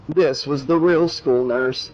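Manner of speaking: ordinary delivery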